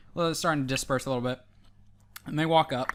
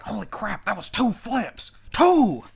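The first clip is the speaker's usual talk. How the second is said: rising to a loud high shout; amazed onlooker; 5 semitones higher, 4 dB louder than usual. Acted. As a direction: build to a stunned yell. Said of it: "some people are like".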